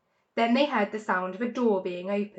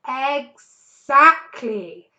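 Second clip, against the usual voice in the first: satisfied; 3 semitones higher, 10 dB louder, much slower than usual. A narrator's reading. Fox pleased.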